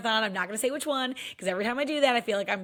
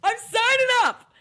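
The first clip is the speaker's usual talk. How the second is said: excited declaration; 13 semitones higher, 7 dB louder than usual. Retold or imitated. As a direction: declare it to the whole room. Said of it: "And I said".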